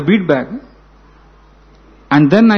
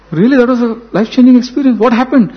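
retold astonishment; 6 semitones higher than usual. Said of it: "this was a bigger shock for me".

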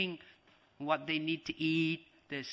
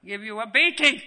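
loud, raised-pitch parental threat; acted furious scolding; threatening parent; 7 semitones higher, 13 dB louder than usual. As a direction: shout it as an angry parent's warning. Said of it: "how did the parents reward me?".

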